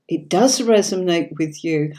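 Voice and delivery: usual speaking voice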